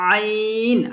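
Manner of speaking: আইন pushed out with throat pressure; clearly pressed আইন; pressed ain. Shown as a demonstration. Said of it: "চাপ দিয়ে উচ্চারণ করতে হয়".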